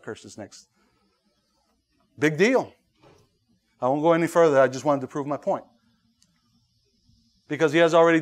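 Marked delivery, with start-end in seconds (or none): none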